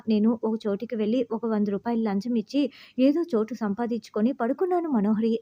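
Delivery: usual speaking voice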